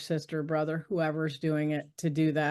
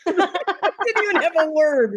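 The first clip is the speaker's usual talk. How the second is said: real laughter, higher and louder; laughing; 11 semitones higher, 5 dB louder than usual. Real, not acted. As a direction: laugh at yourself as you say it. Laughing at herself.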